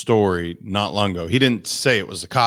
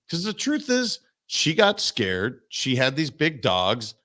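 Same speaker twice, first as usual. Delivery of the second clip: ordinary delivery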